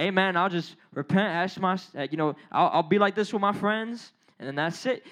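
low, lightly voiced casual excuse; casual hypocrite. Hypocrite excusing himself to God, lightly voiced and offhand. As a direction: shrug it off in a low mumble of an excuse. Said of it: "being a hypocrite".

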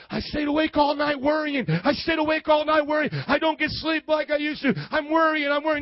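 loud, high, frantic worrying; mock anxious fretting. Sleepless worrier complaining to the preacher, mock panic. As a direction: fret it out, wound up tight.

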